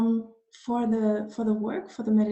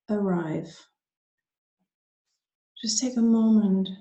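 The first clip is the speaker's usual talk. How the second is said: guided meditation. Acted. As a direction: ease them gently inward, unhurried, leaving space after each phrase.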